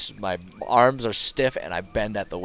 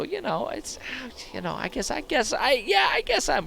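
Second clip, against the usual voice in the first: higher, squirming hedge; mock waffling. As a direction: waffle like you're cornered. Hedging in a squeaky voice.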